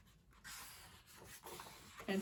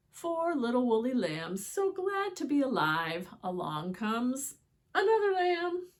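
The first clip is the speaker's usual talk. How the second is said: storybook reading; 3 semitones higher, 7 dB louder than usual. A narrator's reading. Reading picture book aloud.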